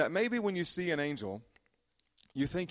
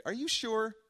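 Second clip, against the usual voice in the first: incredulous question; 10 semitones higher than usual. Retold or imitated. Mocking Zechariah's doubting question.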